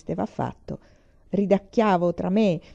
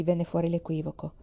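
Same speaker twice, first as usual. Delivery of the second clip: ordinary delivery, nothing marked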